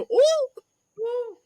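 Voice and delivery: very high falsetto "ooh"; falling wail. Retold or imitated. Son falls from the tower, high wailing ooh. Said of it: "You hear the son go".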